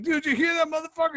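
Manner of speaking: high, quick, gleeful disbelief; incredulous glee. Retold or imitated. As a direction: squeal it to your buddy, amazed. Drunk, delighted at sergeant's squeaky voice.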